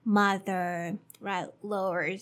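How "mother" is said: mother with a relaxed er. Shown as a demonstration. Teacher giving mother as an example. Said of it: "like in mother".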